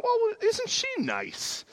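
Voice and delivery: very high, slow sing-song; put-on sugary high voice; mock-sweet thought. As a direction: coo it naively, way up high. Voicing his naive thought about trainer.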